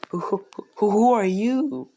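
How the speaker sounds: high, stammering startled mother voice; stammer, then stretch the question high and loud; acted freak-out. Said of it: "she's freaking out".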